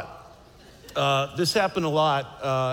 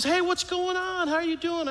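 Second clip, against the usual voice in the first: much higher bubbly phone greeting; cheerful Michelle; 12 semitones higher than usual. Retold or imitated. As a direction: voice her as a chirpy old friend. Michelle answers phone, retold.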